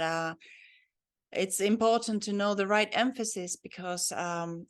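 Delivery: usual speaking voice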